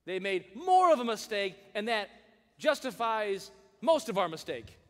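a hint of indignant blame; mock self-justifying. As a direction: whine the excuse.

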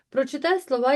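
ordinary talk

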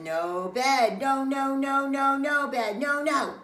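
loud, high, repeated protest; insistent refusal. Acted. Child rolls about refusing bed, loudly insistent. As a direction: chant it stubbornly on one high note.

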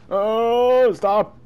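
loud, high, stretched cry; startled yell. Real, not acted. Player attacked in the shark game, alarmed yell. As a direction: cry out for it to stop.

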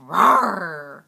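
deep growling rawr; monster growl. Pretend. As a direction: growl like a beast. Growl in play voice.

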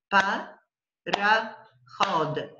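"Pa, ra, hod" as three beats with pauses; syllable by syllable. Shown as a demonstration. Teacher shows possible split to student, syllable by syllable. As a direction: clap out "Pa, ra, hod" one piece at a time. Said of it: "separate the words in syllables".

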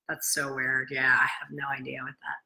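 low whisper, unsettled; spooked whisper. Real, not acted. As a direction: breathe it out, uneasy. Spooked by strange music again.

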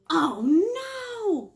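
loud high alarm; dismayed oh no. Acted. Librarian reacting to the mouse for kids, loud dismay. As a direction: gasp out the dismay.

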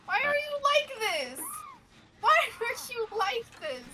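high, drawn-out complaint; plaintive protest. Real, not acted. Player asking the killer why, high plaintive protest. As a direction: stretch it out high, sliding down at the end.

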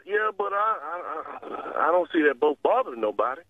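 normal talking voice

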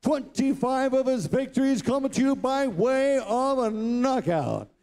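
usual speaking voice

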